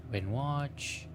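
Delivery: ordinary talk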